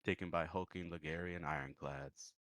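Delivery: unhurried storyteller telling a bayou tale; story narration. A narrator's reading. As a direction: draw the table into the old story.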